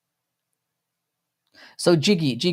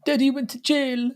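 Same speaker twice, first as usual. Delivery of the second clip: mock sob-story voice; 13 semitones higher than usual. Mocking the fighter's sob story.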